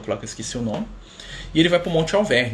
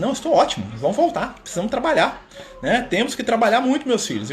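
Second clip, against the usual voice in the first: bright cheerful rally; 9 semitones higher than usual. Retold or imitated. Francisco cheerful after vision.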